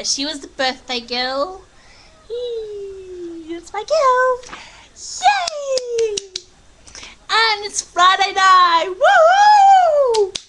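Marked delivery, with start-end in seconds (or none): 2.3-10.4 high, whooping celebration; excited cheering. Real, not acted. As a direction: squeal and whoop, building to a loud cheer. Cheering birthday girl and Friday night.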